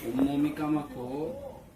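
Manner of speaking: slow, careful dictation; dictating. Shown as a demonstration. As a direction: read each syllable slowly and clearly. Dictation quiz, slow repeat.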